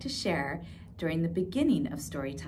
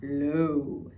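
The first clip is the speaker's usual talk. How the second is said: low voice; 5 semitones lower than usual. Shown as a demonstration. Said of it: "That's right".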